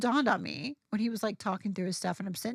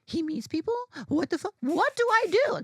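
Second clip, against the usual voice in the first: rising higher and louder in a nerdy panic; panicked excitement; 7 semitones higher, 4 dB louder than usual. Retold or imitated. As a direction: freak out more with every question. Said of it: "So I was like".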